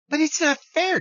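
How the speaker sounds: high nasal-free mock whine of an objector; mock whiny objector. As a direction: parody a complaining fan cutting in. Imagined critic objects again.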